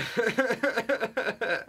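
high, helpless laugh; high squealing laugh; burst of laughter. Real, not acted. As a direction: crack up at the joke. Laughing at crude pickup line.

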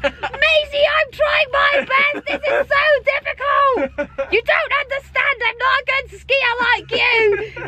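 high, slow, whining yell; whiny shouting. Retold or imitated. Girl complaining to Maisie that skiing is hard, high slow whiny shout. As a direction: wail it like a struggling beginner skier. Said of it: "they were pure shouting each other".

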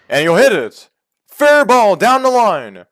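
loud, high hit call; excited call. Acted. Gamer calling a hit down the line, loud. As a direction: sell the fair ball to the viewers.

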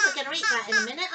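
ordinary delivery

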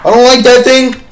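high frightened yelp; scared. Real, not acted. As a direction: panic at the creature. Scared of monster.

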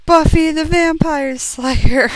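slow, loud, high announcement; hammed-up reveal of the villain; dramatic reveal.

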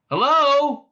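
high, loud hail; calling out. A narrator's reading. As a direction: call out to see who answers. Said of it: "called out Ford".

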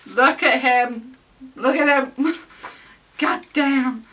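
loud, high, giddy admiration; excited gushing. Real, not acted. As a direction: exclaim it loudly, breaking into laughter. Gushing over idol's photo.

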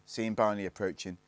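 plain reading voice

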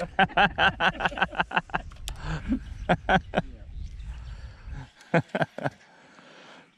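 long, high, loud laughing fit; helpless laughter. Real, not acted. Mates after the ventriloquist punchline, uproarious laughter. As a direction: burst out in rapid cackles.

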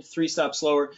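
usual speaking voice

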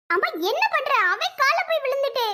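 high, urgent dismay as mother kneels; a daughter horrified her mother begs at his feet; alarmed daughter. Acted. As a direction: cry out to stop her mother.

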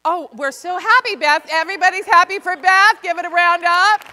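loud, high celebratory announcement; excited cheering. Real, not acted. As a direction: whip the room up into applause. Leading room's cheer for Beth.

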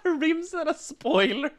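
high, slow, giggly repeat; delighted laugh through the words. Real, not acted. Laughing repetition.

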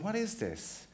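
ordinary delivery

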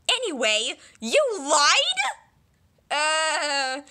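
usual speaking voice

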